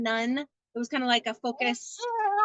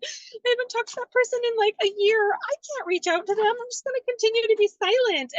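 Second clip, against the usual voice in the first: high, anxious self-talk, pitched way up; hammed-up fretful self; whiny inner voice; 10 semitones higher, 3 dB louder than usual. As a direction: whine it like your own worried inner voice. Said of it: "I'm always like".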